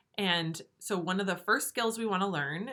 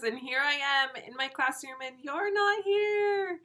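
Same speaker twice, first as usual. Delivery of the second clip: drawn-out, high, louder playful whine; mock lament; 7 semitones higher, 4 dB louder than usual. Real, not acted. Teacher missing her absent first graders, mock lament. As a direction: stretch it out high in exaggerated dismay.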